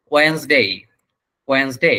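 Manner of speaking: "ওয়েডনেসডে" in two syllables, wens-dei, no d before the n; correct pronunciation. Shown as a demonstration. Teacher gives the right form, correct pronunciation "ওয়েডনেসডে" as wens-dei. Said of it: "এটার উচ্চারণ হচ্ছে".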